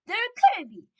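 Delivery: high, loud outcry; disbelieving shout. A narrator's reading. Other witches doubting the report, shouting. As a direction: cry out your disbelief. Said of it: "the others shouted".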